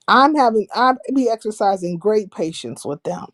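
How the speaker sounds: ordinary talk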